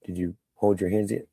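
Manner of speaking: ordinary talk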